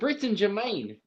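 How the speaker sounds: high mock Kiwi accent; New Zealand accent. Calling the band roll, high New Zealand accent. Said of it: "you are doing Flight of the Conchords".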